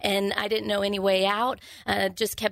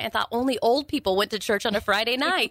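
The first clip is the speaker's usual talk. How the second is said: normal talking voice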